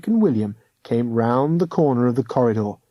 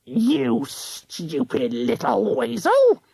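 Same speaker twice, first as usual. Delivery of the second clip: slow hissing witch voice, much higher; hissed; 8 semitones higher, much slower than usual. A narrator's reading. Ghost hunter clawing at Roddy, slow hiss. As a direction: spit it out slowly like a hag. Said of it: "Missus Croker's voice hissed".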